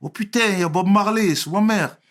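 high, loud mock Marseille accent. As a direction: imitate Marseille inmates exclaiming at a newcomer. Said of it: "j'entends les Marseillais parlant trop".

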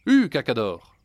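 loud, high call to a donkey; exclaiming. A narrator's reading. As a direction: urge the stubborn donkey on. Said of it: "Antuono s'exclama alors".

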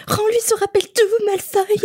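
high, bold hero cry; heroic demand. Pretend. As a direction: call it out like a brave kid. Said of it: "je pense que je fais".

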